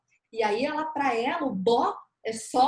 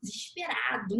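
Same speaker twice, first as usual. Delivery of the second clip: ordinary talk, nothing marked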